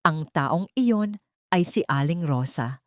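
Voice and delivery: plain reading voice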